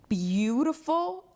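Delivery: "beautiful" stretched slowly, pitched high; drawn-out emphasis. Real, not acted. Woman describing the car she saw, "beautiful" drawn out. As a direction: savour "beautiful", dragging it out high.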